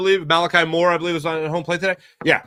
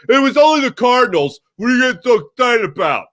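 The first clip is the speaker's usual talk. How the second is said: higher, louder, sneering voice of someone you dislike; mock the killjoy who downplays the win; put-on voice of a dismissive coworker; generic annoying person voice; 7 semitones higher, 5 dB louder than usual. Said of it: "That's my generic voice for people I don't like".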